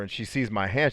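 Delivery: ordinary delivery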